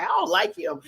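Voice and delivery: put-on high, whiny kid sneer; mocking bullies. Imagining bullies rejecting her brother, high whiny sneer. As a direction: mimic a sulky bully. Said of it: "They'd have been like".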